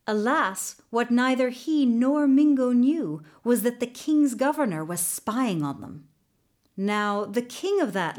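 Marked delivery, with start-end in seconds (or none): none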